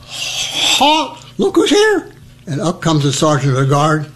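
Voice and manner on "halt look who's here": a hammed-up high call of halt; high mock call. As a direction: squeak it out like a panicking rookie. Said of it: "and I hear".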